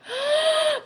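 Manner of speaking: sudden high gasp of surprise; sharp gasp. Real, not acted. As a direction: gasp as the card shocks you. Shocked by the drawn card.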